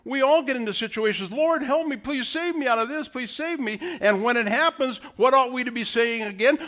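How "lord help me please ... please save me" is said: pleading prayer. Acted.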